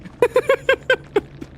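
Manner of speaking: squeaky burst of laughter; shrill-ish laughing squeal; high squealing laugh. Real, not acted. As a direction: let out a high giggle. High laugh after joke.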